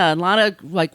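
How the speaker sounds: normal talking voice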